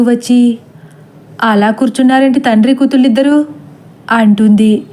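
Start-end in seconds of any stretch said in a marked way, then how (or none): 1.4-3.4 quick, impatient aunt's question; aunt quickly chiding father and daughter; brisk scolding. Acted. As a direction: rattle it off, puzzled and brisk.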